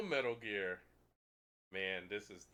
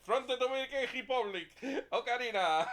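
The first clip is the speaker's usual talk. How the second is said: high, loud, stretched Spanish ballpark call; baseball announcer; 8 semitones higher, 4 dB louder than usual. Pretend. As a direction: announce the batter to the whole stadium. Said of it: "It's like a baseball game".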